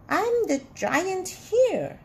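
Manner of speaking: high, slow storybook giant's shout; angry shout. A narrator's reading. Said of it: "he shouted".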